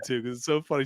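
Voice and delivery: ordinary talk, nothing marked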